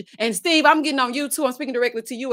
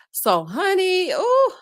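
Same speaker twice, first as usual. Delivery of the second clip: drawn-out gossip; 8 semitones higher, 4 dB louder, much slower than usual. Real, not acted. Gossip lead-in.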